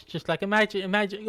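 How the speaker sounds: usual speaking voice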